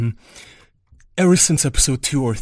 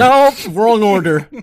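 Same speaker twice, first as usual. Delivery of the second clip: loud mock protest; 8 semitones higher, 9 dB louder than usual. Real, not acted. Host objects to ad order.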